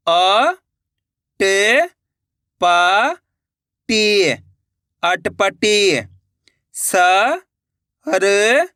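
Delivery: "म… ज… ह… बी", then "मजहबी" joined; each letter held alone, then the whole word slowly; slow reading drill, "स… र… का… री" into "सरकारी"; syllable-by-syllable reading. Shown as a demonstration. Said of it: "एक एक अक्षर का उच्चारण".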